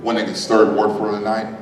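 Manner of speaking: suspenseful awards presenter. Acted. Announcing award winner.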